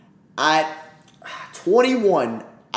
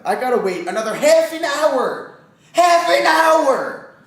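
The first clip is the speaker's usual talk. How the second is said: high, loud, slowed outrage; exasperated; 12 semitones higher, 6 dB louder than usual. Real, not acted. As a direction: drag out the complaint. Outraged at the long wait.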